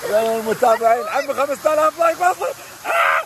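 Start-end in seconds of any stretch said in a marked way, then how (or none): 2.9-3.2 sharp high yelp from icy water; cold shock yelp. Real, not acted. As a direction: cry out at the freezing shock. Entering ice water.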